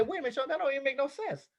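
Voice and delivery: mock the skeptical listener, fast; audience objection. Listener objecting to Sean, fast mock doubt. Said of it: "And you say".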